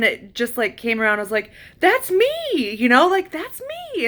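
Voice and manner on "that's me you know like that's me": very high, elated cry; joyful recognition. Retold or imitated. Realizing the light is herself, very high elated cry. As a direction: gasp it out in wonder. Recognizing her own soul.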